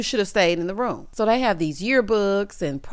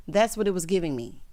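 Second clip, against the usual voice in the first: usual speaking voice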